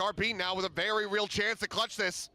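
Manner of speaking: excited live play-by-play; esports caster. Acted. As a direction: call the clutch as it happens.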